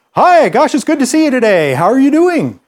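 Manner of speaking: high, loud, sing-song announcer greeting; high bouncy radio greeting. Shown as a demonstration. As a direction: beam it out like a cheery radio host. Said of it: "like maybe a radio announcer".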